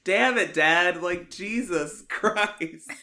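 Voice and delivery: loud, high exasperated outburst; half-laughing mock scolding of dad. Exasperated outburst at dad's needless call.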